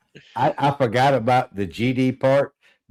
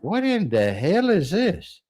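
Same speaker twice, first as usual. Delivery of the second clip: baffled; 7 semitones higher than usual. Retold or imitated. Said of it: "I'm like".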